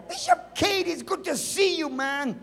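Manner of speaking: high-pitched, gushing hello; mock effusive greeting. Churchgoer greeting the bishop during altar call, mock effusive. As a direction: greet someone high, then settle down.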